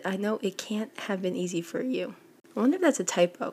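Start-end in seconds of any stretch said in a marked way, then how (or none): none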